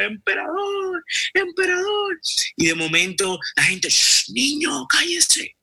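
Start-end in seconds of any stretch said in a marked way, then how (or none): 0.0-2.2 very high, slow shout from afar; child shouting. Acted. Beggar boy shouting to the passing emperor, very high and stretched. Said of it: "Y empezó a gritar". 3.9-5.6 high, urgent shushing villagers; hushing crowd. Acted. Villagers hushing the beggar boy beside the road, high and urgent.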